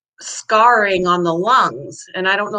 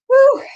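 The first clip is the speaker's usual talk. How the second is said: breathless woo; 6 dB louder than usual. Real, not acted. After climbing stairs.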